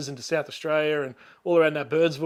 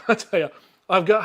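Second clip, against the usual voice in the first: usual speaking voice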